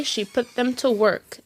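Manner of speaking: ordinary delivery, nothing marked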